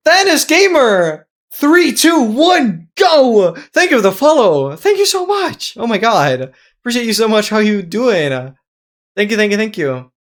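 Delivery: loud, high-pitched celebratory yell; real excited shouting, voice way up; hyped follower shout-out. Real, not acted. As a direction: celebrate the new follower at full volume. Hyped thanks for a new follower.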